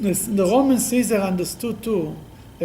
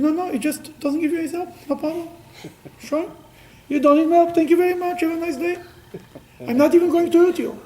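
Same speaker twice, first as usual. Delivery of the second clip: high, mock-polite brush-off; sarcastic God; 9 semitones higher than usual. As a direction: shrug them off with sugary sarcasm. Voices God answering sarcastically.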